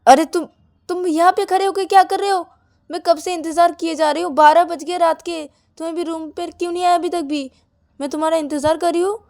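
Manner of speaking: high, loud reproach; indignant scolding. A narrator's reading. Bride confronting partner waiting outside at midnight, indignant.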